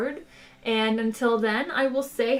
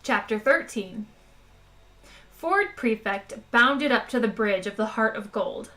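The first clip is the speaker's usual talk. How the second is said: plain reading voice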